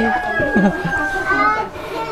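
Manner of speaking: high, airy laugh; soft high chuckle. Real, not acted. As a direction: let out a light, high laugh. Laughs after making woman cry.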